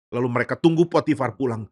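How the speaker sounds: plain reading voice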